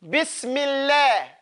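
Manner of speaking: slow "Bismillah" with front "laah"; light lam. Shown as a demonstration. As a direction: draw out a thin final "lah" in "Bismillah". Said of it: "when it's a kasra".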